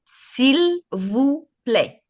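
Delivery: "S'il vous plaît" spoken very slowly, each syllable apart; slow clear model. Shown as a demonstration. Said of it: "can you pronounce that again for me".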